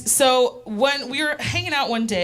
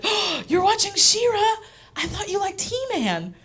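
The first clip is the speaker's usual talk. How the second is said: high, teasing mom voice, pitched up; imitating mom; 10 semitones higher than usual. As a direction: chirp it like a prying mother.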